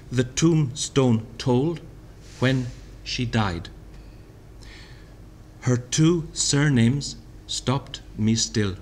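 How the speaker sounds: slow poetry reading; poem reading. Shown as a demonstration. Lecturer reading Thomas's poem aloud, slow. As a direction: read the lines slowly and gravely.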